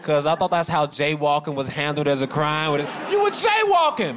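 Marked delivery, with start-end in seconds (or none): 3.1-4.2 high, exaggerated cop accusation; comic high-voiced policeman; mock accusing cop. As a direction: mimic the officious officer, pitched way up. Repeats the cop's accusation.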